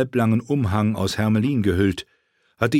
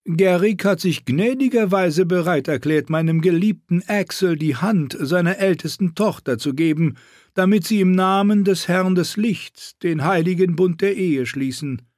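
evil queen; 9 semitones higher than usual. Acted. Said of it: "sagte Königin Selyse".

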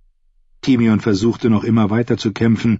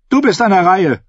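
raised, higher-pitched challenge; combat challenge; 11 semitones higher, 4 dB louder than usual. A narrator's reading. Brienne to Timion as he draws a second spear, loud challenge. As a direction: call out your opponent.

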